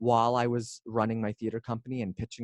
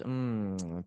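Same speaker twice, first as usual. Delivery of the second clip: usual speaking voice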